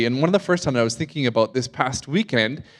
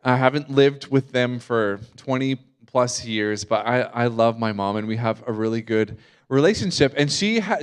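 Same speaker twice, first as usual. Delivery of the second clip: normal talking voice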